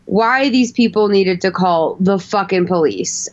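ordinary talk, nothing marked

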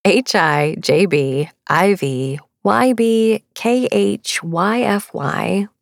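slow, letter by letter; careful, drawn-out spelling. Real, not acted. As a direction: spell it slowly, each letter separate. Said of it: "I'm gonna have to spell this".

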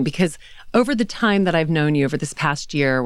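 ordinary talk

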